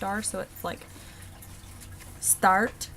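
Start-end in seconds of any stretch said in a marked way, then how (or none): 2.2-2.9 "start" with a clear, crisp Irish R; Irish accent, untrilled R in "start". Shown as a demonstration. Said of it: "R sound is very important in the Irish accent".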